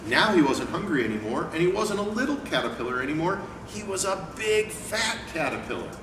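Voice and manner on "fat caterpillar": usual speaking voice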